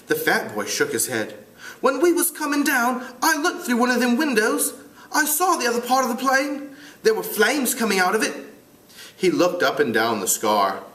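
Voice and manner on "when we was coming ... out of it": high British schoolboy voice; amateur British accent, pitched up. Acted. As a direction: play a piping British boy. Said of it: "try to do it with a British accent".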